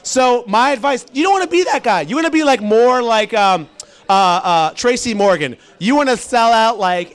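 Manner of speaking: ordinary talk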